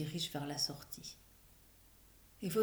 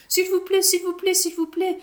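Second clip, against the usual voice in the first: high, loud repeated pleading; begging; 12 semitones higher, 8 dB louder than usual. A narrator's reading. Children begging the director to allow the play. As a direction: beg like eager kids.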